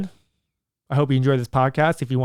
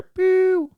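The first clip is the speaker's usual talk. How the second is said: playful pew sound. Real, not acted. Shows off the gym shirt.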